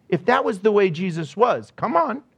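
normal talking voice

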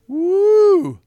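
high loud whoop. Real, not acted. Preacher to the congregation, a loud high whoop. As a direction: let out a big high whoop. Exclaiming about pain.

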